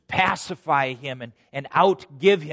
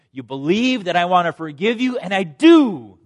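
raised, loud declaration of forgiveness; emphatic assurance; 4 semitones higher, 10 dB louder than usual. Acted. Jesus assures Zacchaeus he is forgiven, loud and emphatic.

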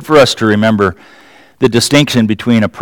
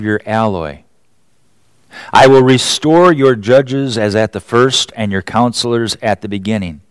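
scripture reading; 2 semitones lower, 4 dB quieter than usual. Acted. Scripture reading, softer and slower.

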